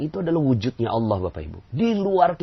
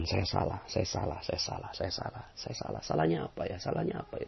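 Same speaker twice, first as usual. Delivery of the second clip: fading into a whisper; humble whispered istighfar; whispered confession; 6 semitones lower, 12 dB quieter than usual. Shown as a demonstration. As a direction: breathe it out, sincerely admitting fault. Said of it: "Harusnya ucapkan".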